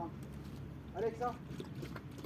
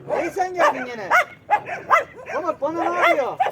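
loud high alarmed calls; excited shout; 7 semitones higher, 11 dB louder than usual. Real, not acted. Owner reacting as Pamuk chases another, loud. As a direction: shout out as the dogs clash. Dog chasing another animal.

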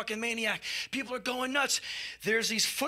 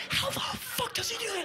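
much higher awed voice; mock amazed fan voice; awed crowd; 5 dB quieter than usual. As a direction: marvel like a stunned fan. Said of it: "Everyone's like".

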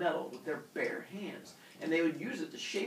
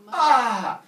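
loud, high drawn-out howl; scream of pain; 10 semitones higher, 17 dB louder than usual. Acted. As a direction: howl in comic agony. Father Sky still screaming.

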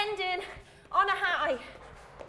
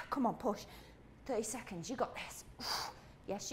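whispered encouragement; 4 semitones lower, 11 dB quieter than usual. Real, not acted. Coach urging, breathless.

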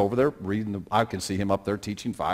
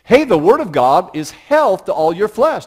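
higher, louder, slow teaching call; imagined Jesus teaching; 9 semitones higher, 10 dB louder, slower than usual. Pretend. Jesus teaching a crowd of five thousand, raised and slow. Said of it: "talking and teaching people".

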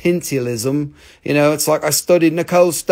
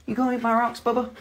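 dog-talk voice; 7 semitones higher, 7 dB quieter, faster than usual. Real, not acted. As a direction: coo at the dog playfully. Teasing the dog.

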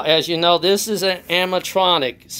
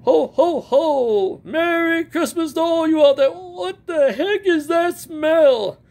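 high, hammed-up jolly Santa; 12 semitones higher than usual. Animatronic Santa greets the fans in a high jolly voice. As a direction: boom out ho-ho-ho like a toy Santa.

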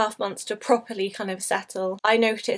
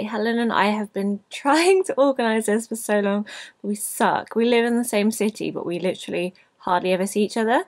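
normal talking voice

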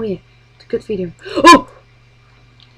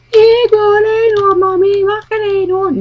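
put-on high pleading little-kid voice; whiny child; 10 semitones higher than usual. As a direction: beg like a small child for a unicorn. Child begging mother in skit.

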